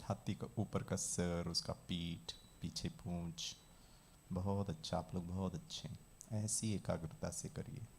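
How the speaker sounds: low, soft, measured instruction; guided visualization. Shown as a demonstration. Teacher guiding a class to picture an elephant, calm visualization voice. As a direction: guide listeners gently through each picture.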